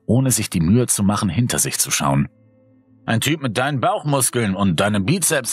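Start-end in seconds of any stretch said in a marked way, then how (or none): 3.1-5.5 higher, mocking jab at his muscles; light teasing with a chuckle; playful teasing. A narrator's reading. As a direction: rib him with a laugh. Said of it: "stichelte Eli".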